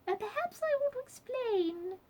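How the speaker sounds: very high-pitched squeak; squeaky Duchess. A narrator's reading. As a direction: squeak an explanation in a tiny high voice. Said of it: "she squeaked".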